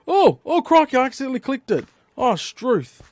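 startled yelp. Real, not acted. Accidental click in game.

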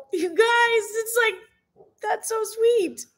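high, slow, moved; overwhelmed by the sweet gesture; touched. Real, not acted. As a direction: stretch it out, deeply touched. Touched by friends' note.

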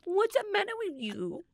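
put-on squeaky high baby voice; mocking baby talk. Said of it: "you just have a general baby voice".

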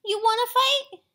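very loud, high-pitched dare; storybook shout for a fight; acted challenge. A narrator's reading. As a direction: yell the challenge like a pugnacious little bug. Grouchy ladybug challenges a sparrow.

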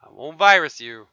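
ordinary talk, nothing marked

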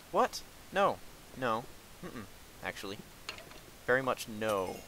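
ordinary delivery

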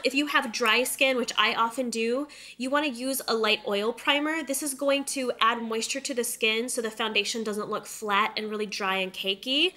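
ordinary talk

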